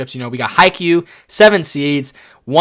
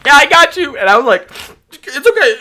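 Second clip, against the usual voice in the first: much higher, louder chirpy reassurance; forced cheerfulness; 12 semitones higher, 8 dB louder than usual. Retold or imitated. Attendant hiding tears from the climbing families, forced cheer. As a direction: cover the tears with brightness.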